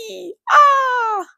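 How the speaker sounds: a very high squeal then a cry; cartoon squeal of pain; punched yelp. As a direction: yelp as the fist lands. Imitating being punched.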